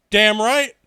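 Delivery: the Australian guy backing up the Kiwi, loudly; emphatic agreement. Retold or imitated. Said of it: "The guy from Australia was like".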